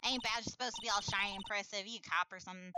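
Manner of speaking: sceptical Southern country voice; exaggerated Southern drawl. Woman doubting a badge, Southern drawl. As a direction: question them in a Southern drawl. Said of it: "she has an exaggerated drawl".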